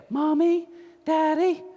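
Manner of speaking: put-on high childlike singsong voice; mock their high, cutesy calling; mocking grown-up daughters. Making fun of wife's family saying mommy, mocking high voice. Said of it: "they say it like that".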